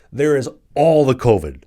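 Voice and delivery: loud, high, hammed-up sarcasm: "all the covid" belted out; loud mocking. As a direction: belt "all the covid" like a mock alarm. Mocking airplane covid rules.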